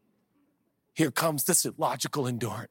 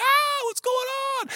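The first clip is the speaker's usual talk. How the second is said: mock panicked cry; 3 dB louder than usual. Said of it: "and you go".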